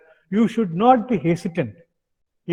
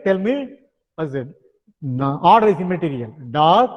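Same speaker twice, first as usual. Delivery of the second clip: ordinary talk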